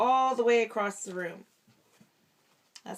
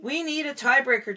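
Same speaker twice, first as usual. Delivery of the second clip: higher, louder eager call; eager Jessie; 5 semitones higher, 6 dB louder than usual. A narrator's reading. Dad reading, Jessie demands a tiebreaker eagerly. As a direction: push for it eagerly. Jessie line in read story.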